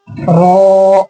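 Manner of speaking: रॉ with a rounded, heavy "aw" vowel; heavy, drawn-out रॉ; thick, full R. Shown as a demonstration. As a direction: say रॉ thick and long. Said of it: "मोटा पढ़ते हैं इसको".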